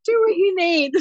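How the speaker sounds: hammed-up teary pity for a brother; mock gushing sympathy.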